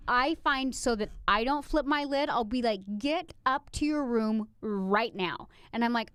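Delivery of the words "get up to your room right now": put-on slow, controlled command to a child; stern parent order. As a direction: spell out the order firmly without shouting. Acts out ordering her daughter upstairs.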